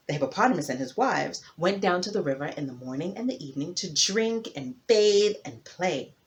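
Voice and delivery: ordinary delivery, nothing marked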